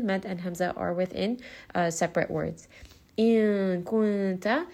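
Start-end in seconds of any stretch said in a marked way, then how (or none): 3.2-4.7 In kunta very slow, the nun hidden in a nasal hum. Shown as a demonstration. Teacher demonstrating ikhfa, slow nasal recitation. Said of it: "this is going to be ikhfa".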